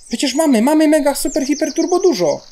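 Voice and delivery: high-pitched, incredulous protest; exasperated protest. Real, not acted. Player arguing with the game over supplies, high exasperation. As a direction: plead your case, voice pushed way up.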